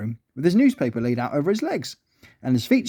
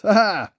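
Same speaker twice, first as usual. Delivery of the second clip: short acted "ha ha"; pleased schemer's laugh; higher-pitched gleeful storybook laugh; 4 semitones higher, 3 dB louder than usual. A narrator's reading. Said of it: "he laughed".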